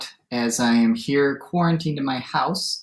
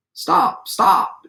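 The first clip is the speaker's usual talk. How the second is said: raised-voice call to halt; mild shout; 11 semitones higher, 5 dB louder than usual. A narrator's reading. Longhorn steer tries to halt the cowboy, mild shout. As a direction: call out to stop a runaway. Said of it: "shouted the longhorn steer".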